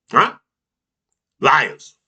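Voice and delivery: ordinary talk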